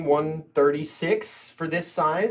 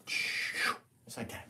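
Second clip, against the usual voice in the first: whispering; 3 semitones lower, 11 dB quieter, much slower than usual. Real, not acted. Painter murmuring softly.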